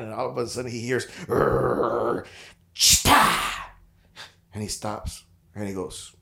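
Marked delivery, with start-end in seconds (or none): 1.3-3.3 dog growl and yap. Retold or imitated. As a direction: growl first, then bark out chita. Dog growls then yaps.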